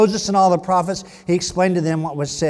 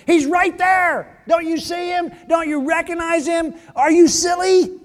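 yelling at the screen; 11 semitones higher, 5 dB louder than usual. Retold or imitated. Preacher yells at disciples as if at a movie screen, exasperated shout. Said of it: "you wanna yell".

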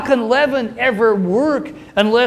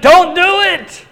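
loud high plea; cry out; 11 semitones higher, 11 dB louder than usual. Retold or imitated. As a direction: shout to stop him from jumping. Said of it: "he just cries out".

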